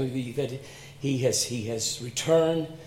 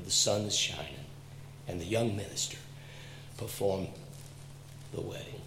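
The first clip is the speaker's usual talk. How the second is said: hushed telling; 7 semitones lower, 12 dB quieter than usual. Acted. Quiet tender close.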